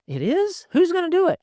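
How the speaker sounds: put-on very high, startled kid's "It is? Who's gonna do it?"; lightly voiced son, alarmed; surprised child. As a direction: react like a wide-eyed boy. Son reacting to warning.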